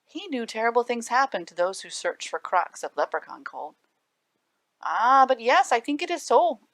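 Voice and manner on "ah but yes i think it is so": firm, certain insistence, louder. A narrator's reading. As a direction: state it with no room for argument. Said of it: "answered the leprechaun firmly".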